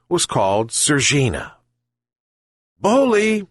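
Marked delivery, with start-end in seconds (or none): 2.8-3.5 high, loud call across the yard; shouting. Acted. Old man calling his dog with a loud high shout. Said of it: "shouted the old man to his dog".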